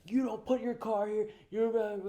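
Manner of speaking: high, loud mock rant; cussing old guy. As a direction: imitate the neighbour losing it. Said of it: "he just starts cussing".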